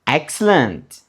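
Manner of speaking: usual speaking voice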